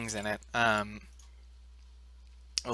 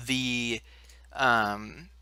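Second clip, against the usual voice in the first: ordinary talk, nothing marked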